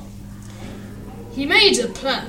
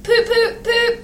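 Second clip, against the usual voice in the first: very high, short imitated toots; train whistle; 9 semitones higher than usual. A narrator's reading. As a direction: toot like an engine whistle. Engine whistles before pulling.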